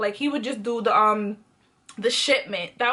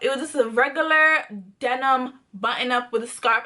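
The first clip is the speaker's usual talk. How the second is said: ordinary delivery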